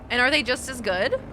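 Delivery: ordinary delivery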